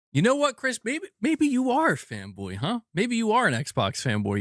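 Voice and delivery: high-pitched playful needling; mock accusation. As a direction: tease him with a high, mock-indignant charge. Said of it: "I'm busting your balls".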